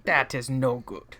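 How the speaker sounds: lightly voiced evil Emperor; a hint of a sinister old Emperor; Palpatine voice. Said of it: "awful Palpatine".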